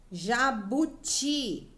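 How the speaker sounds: "Jabuti" very slowly, syllable by syllable, TI held; stressed syllable demo. Shown as a demonstration. Teacher lets children find the tonic syllable, stressing TI in "Jabuti". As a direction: spell out "Jabuti" in syllables, stretching the final TI. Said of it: "Qual que eu falei mais forte?".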